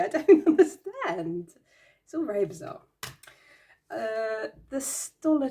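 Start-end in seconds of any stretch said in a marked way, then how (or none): 0.0-1.5 laughing. Real, not acted. Laughing at a bizarre card.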